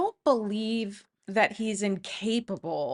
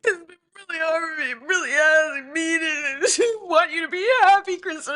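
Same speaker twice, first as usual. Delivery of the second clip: very high, sobbing parody of a weepy confession; mock crying Tom; 6 semitones higher, faster than usual. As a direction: overplay fake tears, voice cracking high. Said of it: "But he's like".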